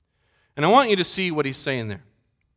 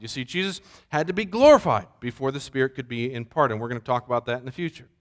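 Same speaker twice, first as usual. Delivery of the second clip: ordinary talk, nothing marked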